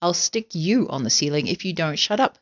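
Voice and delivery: ordinary delivery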